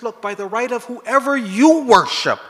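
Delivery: loud, slow desperate plea; old man pleading with the guards, loud and desperate; begging. Retold or imitated. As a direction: beg by all that is holy. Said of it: "He starts begging him".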